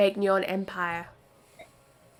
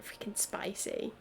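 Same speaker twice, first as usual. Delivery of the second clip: whispered; 14 dB quieter than usual. Real, not acted. Whispered aside during game reading.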